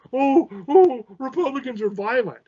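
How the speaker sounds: high, whiny mock crying; hammed-up whiny protester voice; mock crybaby. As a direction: mimic a crybaby protester wailing. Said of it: "they'll cry then".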